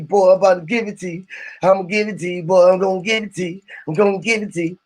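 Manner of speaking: normal talking voice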